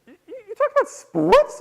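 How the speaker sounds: high, loud stammering disbelief; sputter, then shriek the question; hammed-up incredulity; appalled wife.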